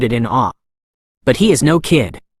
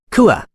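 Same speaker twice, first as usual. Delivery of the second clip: pained scream; 9 semitones higher than usual. A narrator's reading. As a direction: yelp as he is struck again. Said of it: "Ju Tae-yoon screamed".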